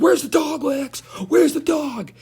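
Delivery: high-pitched, drawn-out mock wail; hammed-up frantic cry; mock crying demand. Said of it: "starts crying".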